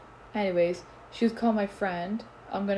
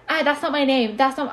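mock whiny complaint; 4 semitones higher, 6 dB louder than usual. Mocking the person who complained.

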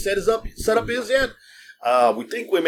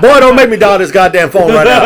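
louder laughing threat; mock tough talk; 4 semitones higher, 8 dB louder than usual. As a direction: playfully bark a fake threat. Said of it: "I talk that shit".

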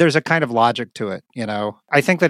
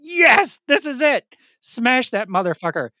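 high, gleeful battle cry; excited cheer; 11 semitones higher than usual. Retold or imitated. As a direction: hype yourself up to smash. Excited reaction to hammer.

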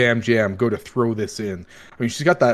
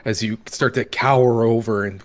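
ordinary talk

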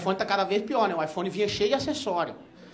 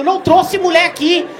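high, loud defensive protest; loud denial; 11 semitones higher, 12 dB louder than usual. Retold or imitated. Accused by his girlfriend, a loud high denial. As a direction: protest loudly, pitched up.

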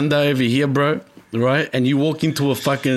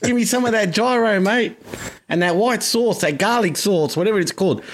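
mock Aussie accent; 7 semitones higher than usual. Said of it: "they'd be like".

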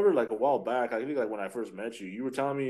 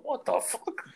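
high mock outrage; slower than usual. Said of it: "It's all".

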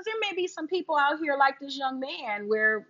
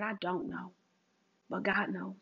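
much softer, lower, calm reply; a quiet certain answer to the aunts; 4 semitones lower, 10 dB quieter than usual. Retold or imitated. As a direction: say it almost under your breath, trusting. Her quiet faith reply.

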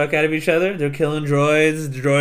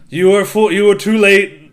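mock villain taunt; 3 semitones higher, 7 dB louder than usual. Dooku taunting the late Jedi, hammed-up gloat. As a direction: ham up the sneering villain.

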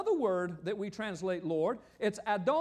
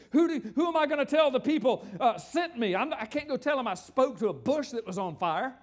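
raised, incredulous voice of a man objecting; protesting Moses; 5 semitones higher, 7 dB louder than usual. Acted. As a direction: act out a baffled protest, loud.

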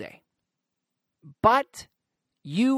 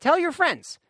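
high bright repeat of Tell your friends; eager plug, repeated; 10 semitones higher, 3 dB louder than usual. Real, not acted. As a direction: push it again, higher. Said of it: "podcast plug".

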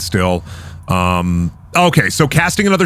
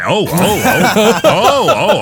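laughing; 7 semitones higher, 3 dB louder, slower than usual. Real, not acted. GM cracking up at the play.

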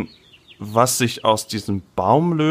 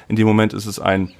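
ordinary delivery

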